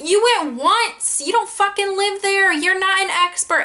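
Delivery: high, slow, fed-up disbelief; exasperated retort. Retold or imitated. Telling her sister off over the New York trip, high and exasperated.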